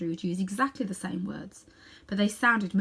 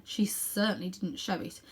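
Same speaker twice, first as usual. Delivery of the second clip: ordinary delivery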